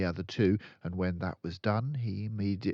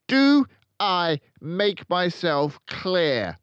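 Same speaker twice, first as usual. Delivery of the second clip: loud, high, slow furious tirade; explosive rage; 12 semitones higher, 12 dB louder than usual. A narrator's reading. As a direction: rage at him, every word a blow. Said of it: "the Trunchbull exploded".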